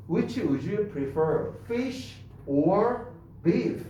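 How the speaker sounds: which would you prefer? Fish or beef? stretched very slowly; very slow demo. Shown as a demonstration. Teacher to class, acting a flight attendant very slowly. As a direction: crawl through the airline question. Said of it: "기내에서 이렇게 물어보면".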